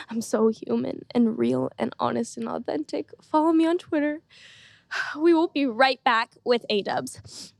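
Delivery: ordinary delivery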